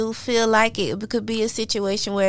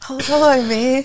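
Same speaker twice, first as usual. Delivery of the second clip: breaking up mid-sentence; fighting back laughter; trying not to laugh; 3 semitones higher, slower than usual. Real, not acted. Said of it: "I was trying to be serious".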